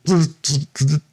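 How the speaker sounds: quick zipping buzz bursts; fairy buzzing. Retold or imitated. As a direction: buzz like a busy little fairy. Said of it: "it was like".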